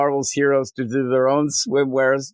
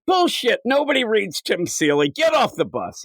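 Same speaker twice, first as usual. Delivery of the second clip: very high mock outburst, then higher sneer; scoffing dismissal; 8 semitones higher than usual. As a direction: scoff and throw him off. Passenger scoffing.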